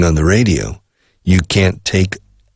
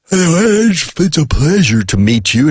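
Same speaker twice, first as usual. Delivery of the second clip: yawned greeting; 9 semitones higher, slower than usual. Retold or imitated. And I start yawning.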